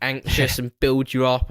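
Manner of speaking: ordinary delivery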